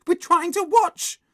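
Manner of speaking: put-on very high, loud child's protest; annoyed scolding. A narrator's reading. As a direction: scold the interrupter in a squeal. Susan scolds Henry in a quarrel.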